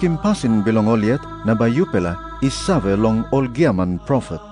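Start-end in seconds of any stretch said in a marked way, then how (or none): none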